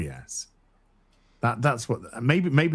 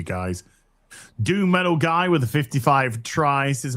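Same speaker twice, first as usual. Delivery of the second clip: ordinary talk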